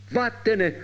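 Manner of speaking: high-pitched cry of dismissal; old man's shout. A narrator's reading. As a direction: cry out to send her away, without full volume. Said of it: "le gridò allora il vecchio". (grido del vecchio, acuto ma contenuto)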